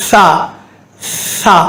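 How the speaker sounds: சா as 'tha' with tongue between the teeth; சா begun with a long airy th. Shown as a demonstration. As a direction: only air through the th of சா. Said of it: "நாக்கு வெளியே வந்து காத்து மட்டும் வரணும்".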